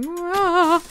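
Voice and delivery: high loud mock roar of a guest; imitating a screaming rider. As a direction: shout Rah like an excited rider. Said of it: "I can hear somebody going".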